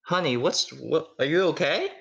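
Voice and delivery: a hint of a fussing mother; mom voice. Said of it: "she says".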